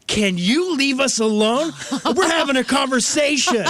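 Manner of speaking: high, slow, drawn-out mock yell; imitated angry yell. Man cutting into a conversation yells to be left alone, imitated. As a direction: imitate an aggressive man telling someone off loudly. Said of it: "he, like, yelled at me".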